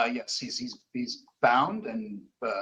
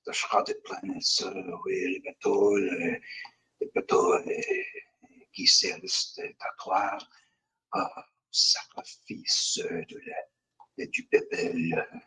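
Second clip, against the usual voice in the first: halting whisper, slipping into French; whispered raving; 4 semitones lower than usual. Acted. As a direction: whisper disjointed words, groping for each one. Captive holds head, raving gibberish.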